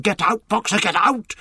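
high, pressing calls; taken-up urgent cry. A narrator's reading. All the animals calling to trapped Boxer, urgent cry. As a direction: urge escape, pitched well up. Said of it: "All the animals took up the cry".

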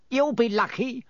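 high, gleeful cackling voice; cackling poltergeist. A narrator's reading. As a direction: relish the bad news like a prankster ghost. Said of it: "said a cackling voice".

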